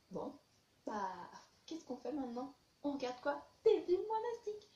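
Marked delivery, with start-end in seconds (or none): 3.6-4.7 bright, loud and high; excited. Real, not acted. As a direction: burst out with the answer. Enthusiastic answer.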